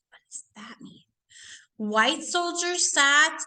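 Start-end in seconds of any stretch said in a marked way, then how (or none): 0.1-1.0 hushed whispered question. Acted. Teacher whispering a question to her students, hushed. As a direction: lean in and whisper it to the class.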